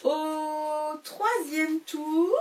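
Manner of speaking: very slow, stretched teasing announcement; mock-dramatic slow opener; drawn-out announcement. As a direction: draw out au troisième tour like a big reveal. Dramatic chapter announcement, then laughs.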